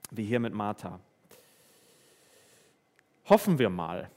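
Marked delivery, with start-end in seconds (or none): 3.3-4.1 half-hearted hope. Pretend. Mock vague hoping.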